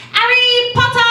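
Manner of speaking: delighted cry of Harry Potter; excited yell. Retold or imitated. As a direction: blurt it out like a thrilled fan. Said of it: "look me dead in the eye and say".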